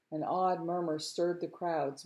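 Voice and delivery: ordinary delivery, nothing marked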